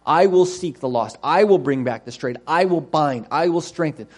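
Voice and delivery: every "I" punched at the head of its phrase; insistent list, hammering the word "I"; emphatic stress on I. Acted. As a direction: drive home "I" and "I myself" each time. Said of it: "a lot of emphasis there on".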